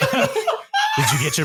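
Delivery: laughing. Real, not acted. Teasing a friend about her film class scene, laughing high. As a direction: laugh and squeal the question.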